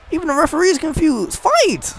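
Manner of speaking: high, fast, then a shout; excited urging. Real, not acted. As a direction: yell at them to get on with it. Urging them to fight.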